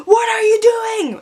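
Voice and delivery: high-pitched, drawn-out outraged yell; imitated yelling. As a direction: mimic an angry woman yelling from her car. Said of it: "she's yelling and she's like".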